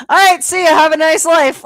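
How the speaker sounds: high, loud, breezy farewell; breezy send-off. Retold or imitated. She leaves Charles at the car, loud chirpy mock goodbye. She cheerfully dismisses him at her door.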